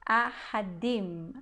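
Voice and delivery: ahadim said slowly, each syllable clear; teacher modelling ahadim slowly; careful Hebrew word. Shown as a demonstration. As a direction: spell out ahadim slowly, like for a class.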